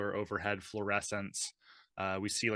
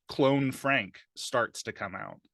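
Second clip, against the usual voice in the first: normal talking voice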